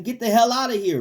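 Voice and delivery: high, loud order to the devil; commanding rebuke. Shown as a demonstration.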